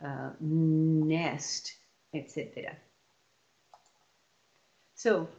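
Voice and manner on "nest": "nest" with a long held n; slow sounding-out of "nest"; stretched sounds. Shown as a demonstration. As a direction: draw out the n in "nest". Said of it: "made of continuous sounds".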